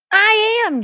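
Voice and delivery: a high, stubborn insistence; insisting. Acted. J J insisting to the doubting boys, high and stubborn. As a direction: dig in and insist. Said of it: "J J insisted".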